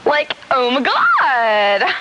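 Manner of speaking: exaggerated, drawn-out Valley Girl exclamation. Shown as a demonstration. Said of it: "A Valley Girl is".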